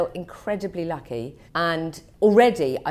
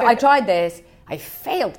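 put-on American accent; 4 semitones higher, 7 dB louder, slower than usual. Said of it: "I'm not even doing American accent".

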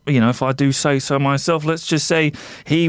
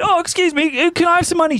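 much higher, whiny mock beggar; 13 semitones higher than usual. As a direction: parody the beggar to show how irritating it is. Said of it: "Just see how irritating that is".

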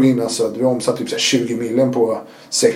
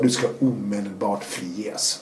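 slow, softer, formal voice of a prison chief; stiff official announcement; 4 semitones lower, 8 dB quieter, slower than usual. Retold or imitated. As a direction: read it out slowly like a stiff official verdict. Prison bosses announcing release. (långsam, stel myndighetsröst)

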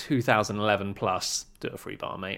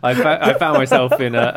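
laughing; 10 dB louder than usual. Real, not acted. Giggling mid-sentence on podcast.

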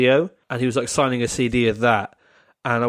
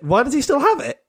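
incredulous outburst; 10 semitones higher than usual. Real, not acted. Horrified disbelief, pitched up.

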